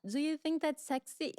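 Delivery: high mock Russian accent; a lady in Milan asks about the shoes, in a mock Russian accent. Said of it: "She had a Russian accent".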